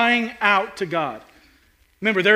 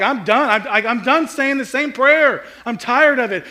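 louder, higher, exasperated outburst; fed-up exasperation; 6 semitones higher, 7 dB louder than usual. Retold or imitated. Preacher recalling giving up on prayer, exasperated outburst. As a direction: sound worn out and done with praying. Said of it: "where I get to the point where I'm like".

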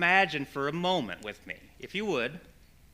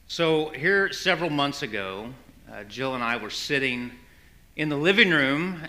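ordinary talk, nothing marked

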